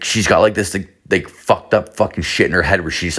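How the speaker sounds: ordinary talk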